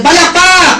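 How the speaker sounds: loud, high call to clap; clap cue shout. Shown as a demonstration. Seminar speaker rallying the crowd to clap, loud high call. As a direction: rouse the room with a shouted cue. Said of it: "Sige nga, practice natin".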